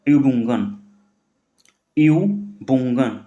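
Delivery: यूबुंगन drawn out very slowly; slow careful यूबुंगन; slow model word. Shown as a demonstration. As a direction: dictate यूबुंगन syllable by syllable. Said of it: "sorry, यूबुंग".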